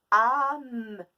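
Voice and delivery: Âme dit fort et haut; mot recollé. Shown as a demonstration. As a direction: proclamer le mot final. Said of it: "le son a est au début". (mot proclamé)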